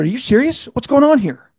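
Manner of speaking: high, shocked disbelief; mock alarm at the news; incredulous. Retold shocked outburst.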